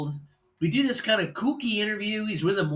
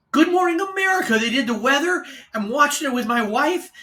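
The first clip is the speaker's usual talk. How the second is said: much higher, louder rush of news; excited telling; 8 semitones higher, 4 dB louder than usual. Retold or imitated. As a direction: gush it out. Coworker still excited.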